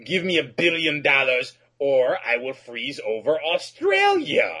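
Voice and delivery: hammy cartoon villain shouting demands; mustache twirling villain. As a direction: ham it up like a cackling bad guy. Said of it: "a mustache-twirling villain".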